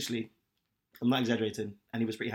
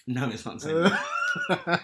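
slow, laughing denial; 4 semitones higher, 3 dB louder, much slower than usual. Real, not acted. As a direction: laugh while denying it. Denies bed noise, laughing.

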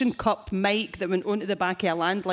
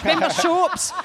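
laughing through it, louder and higher; laughing mock nostalgia; 7 semitones higher, 4 dB louder than usual. Real, not acted. Comedian mocking the crowd's youth, laughing loud. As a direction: tease the crowd about shops while laughing.

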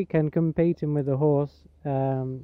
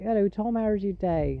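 put-on higher-pitched Gloucestershire farmer accent; farmer's accent; 5 semitones higher than usual. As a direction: greet a guest in West Country farmer accent. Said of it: "traditional farmer's accent".

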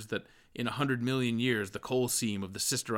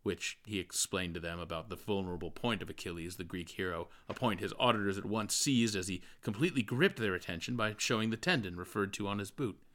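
plain reading voice

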